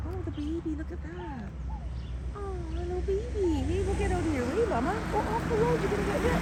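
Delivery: high cooing pet voice; cooing to animals. Real, not acted. Vlogger talking to a mother animal and baby on the road, high coo.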